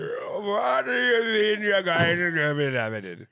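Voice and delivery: put-on like talking mid-yawn, in a British accent; yawning mock accent. Host clowning for his co-host, yawn-drawled British accent. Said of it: "It's terrible, man".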